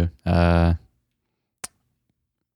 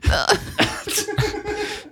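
put-on anime girl voice; slower than usual. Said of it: "the anime girl".

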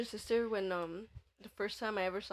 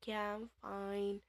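slow, softer tipsy reassurance; drunk sister reassuring; 6 dB quieter, slower than usual. Retold or imitated. Drunk sister being put to bed, slow woozy reassurance. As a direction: drag the words out like a drunk.

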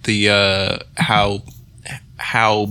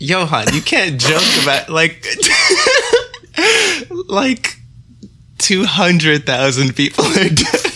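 high, loud, laughing scold; laughing protest; 8 semitones higher, 3 dB louder than usual. Real, not acted.

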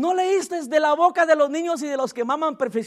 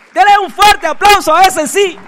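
loud call for applause; 5 semitones higher, 11 dB louder than usual. Real, not acted. Pastor urging the congregation to applaud, rousing shout. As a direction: shout it out, louder and higher, rallying the crowd. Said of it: "asks the church for applause".

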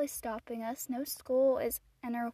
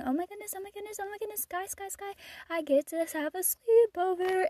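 high squealing oh my goodness; 7 semitones higher, 3 dB louder than usual. Retold or imitated. In public, squealing over a sleepover invite. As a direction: squeal with pure delight. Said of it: "and I squealed".

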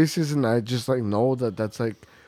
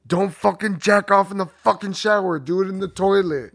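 raised, higher-pitched telling-off; retold yelling; 6 semitones higher than usual. Friend retells yelling at his buddy in the shower, mock yell. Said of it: "we were yelling at you".

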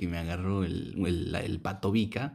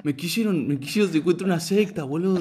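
mock crazed drunk; 9 semitones higher, 4 dB louder than usual. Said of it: "Estoy re loco".

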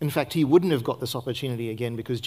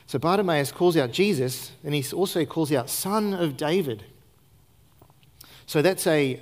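ordinary delivery

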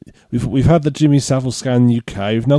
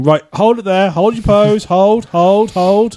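raised-pitch mock photographer calling hold, hold; Peter Parker directing the camera; 6 semitones higher, slower than usual. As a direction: boss the camera around like a fussy photographer.

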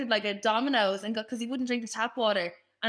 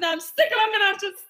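higher, louder, laughing through it; exasperated mum, half laughing; mock fed-up mum; 8 semitones higher, 6 dB louder than usual. Mum fed up with students lounging around, mock exasperated.

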